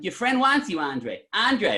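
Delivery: high put-on calling voice; hammed-up holler. Storyteller repeatedly summoning the clerk for a laugh, hammed-up holler. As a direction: call it out teasingly across the store.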